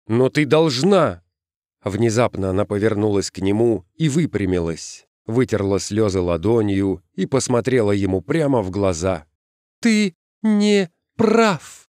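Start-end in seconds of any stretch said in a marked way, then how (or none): 0.1-1.2 higher, stressed appeal; insistent pleading. A narrator's reading. Продолжает умолять подругу. 9.8-11.8 very high, slow, word by word; separate, distinct words. A narrator's reading. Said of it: "произнесла эти слова раздельно и чётко".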